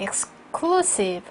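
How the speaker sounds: "Exclusive" slow, stressed on "clu"; second-syllable stress. Shown as a demonstration. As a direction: break before a weighty "clu" in "Exclusive". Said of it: "원래 강세가 이음절에 오죠". ("Exclusive"의 둘째 음절에 강세)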